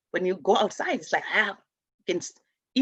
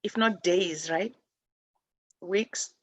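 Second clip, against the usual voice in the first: normal talking voice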